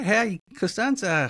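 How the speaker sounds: ordinary delivery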